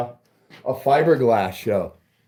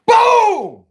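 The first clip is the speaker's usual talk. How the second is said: huge high shout, much louder; 7 dB louder than usual. Real, not acted. To stream viewers about six subwoofers, an explosive shout. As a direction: explode with the punchline. Hyping speaker build on stream.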